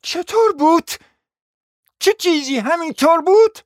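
high, loud outraged cry; shouting. Acted. Hostess shouting back at the guest, outraged. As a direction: yell it out in disbelief. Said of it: "داد زد".

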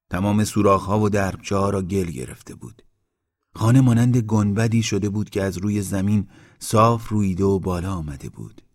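usual speaking voice

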